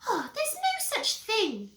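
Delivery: put-on high, loud child voice; whiny little brother. Peter catching Henry in his room, high whine. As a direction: pipe up in a high brat voice.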